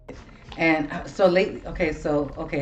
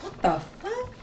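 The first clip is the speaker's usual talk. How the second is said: high, hushed disbelief, rising at the end; baffled reaction; 11 semitones higher, 11 dB quieter than usual. Retold or imitated. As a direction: softly squeak out your confusion. Acting out own baffled reaction.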